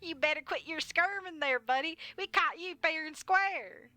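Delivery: high, loud, slow officer voice; Sheriff Blubs. Acted. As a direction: drawl it as a smug small-town sheriff. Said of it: "I'm doing, um, Officer Blubs".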